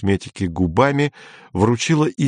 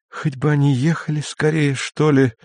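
higher-pitched, mildly grumbling wish; 5 semitones higher than usual. A narrator's reading. Denny waits by the fire for the visitors, grumbling. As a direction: grumble with a touch of impatience. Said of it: "проворчал Дэнни".

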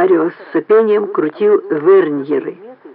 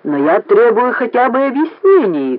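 indignant demand, pitched high; angry high-pitched protest; thundering; 6 semitones higher than usual. A narrator's reading. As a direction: demand answers with raised voice. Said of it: "гремел Марио".

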